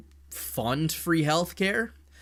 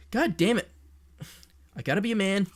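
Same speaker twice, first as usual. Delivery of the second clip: voice quivering; 7 semitones higher, faster than usual. Real, not acted. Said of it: "I'm quivering like a bitch".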